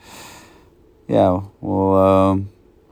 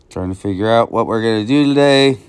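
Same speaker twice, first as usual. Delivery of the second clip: ordinary talk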